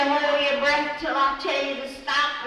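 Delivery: loud, firm order to hold breath; stern instruction. Retold or imitated. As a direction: command the room. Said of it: "She'd say".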